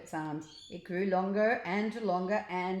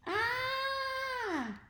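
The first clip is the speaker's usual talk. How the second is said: very high long screech. A narrator's reading. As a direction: screech as he plummets. Rath falls through sky.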